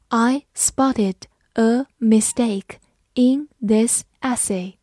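slow, each word set apart; slow model reading. Shown as a demonstration. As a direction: read slowly word by word for learners to follow. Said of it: "好，慢读一遍". (慢速逐词朗读)

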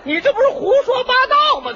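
拔高嗓门不敢相信地反问; 高调的假装急眼; 夸张质问. Acted. 搭档听瞎吹后质问. (捧哏听不下去了，高声质问)

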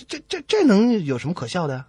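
ordinary talk, nothing marked

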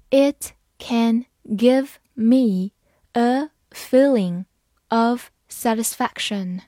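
slow, phrase by phrase; slow reading. Shown as a demonstration. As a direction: read it slowly for learners. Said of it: "好，慢慢来读". (慢慢地读)

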